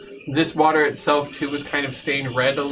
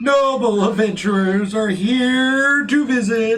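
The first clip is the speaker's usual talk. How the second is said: herald voice; 6 semitones higher, slower than usual. Acted. Announcing their arrival.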